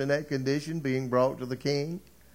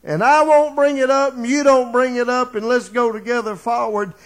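higher and louder, rising to a climax; building preaching; 8 semitones higher, 6 dB louder than usual. Acted. Preacher stirs the congregation with a loud, high rising climax. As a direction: drive the congregation toward hope.

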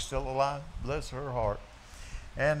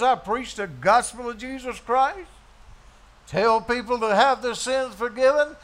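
louder, higher indignant preacher father; indignant dad; 5 semitones higher, 7 dB louder than usual. Retold or imitated. Dad refusing to come back from heaven, indignant. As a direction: scold the caller from heaven. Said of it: "called my dad and said".

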